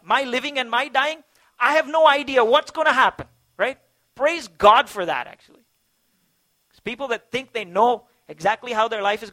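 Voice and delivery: loud, rising sermon delivery, pressing each phrase; fervent pulpit preaching; impassioned preaching. Acted. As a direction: preach it out to the whole room with conviction. Preaching full force to congregation.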